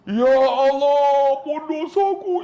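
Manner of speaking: very loud, high, long-drawn mock wail; imitated wailing cry. Pilgrim at the Kaabah begging God, mock bawling. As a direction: bawl it out, stretching every word. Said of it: "dia teriak".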